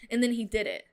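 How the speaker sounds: ordinary delivery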